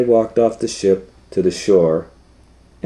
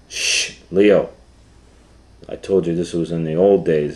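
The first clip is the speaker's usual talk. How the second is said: ordinary delivery, nothing marked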